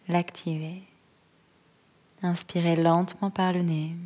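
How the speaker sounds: calm, unhurried yoga nidra voice, long pauses; guided meditation. Acted. Yoga teacher settling listeners into stillness, calm guided-meditation voice. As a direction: guide them gently into stillness, phrase by phrase.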